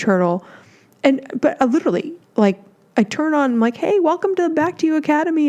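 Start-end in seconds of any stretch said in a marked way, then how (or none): 3.8-5.5 high, fast and cheery greeting; excited hello to a new class; bright welcome. Retold or imitated. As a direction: greet the group like a bubbly host. Cheerful greeting opening the Zoom call.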